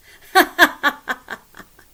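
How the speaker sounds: loud, high staccato laugh; storyteller's cackle. Acted. Storyteller teasing with a spooky tale, loud high cackle. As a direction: burst of ha-ha-has, high and loud.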